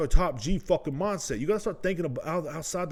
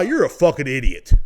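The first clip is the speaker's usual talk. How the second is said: ordinary delivery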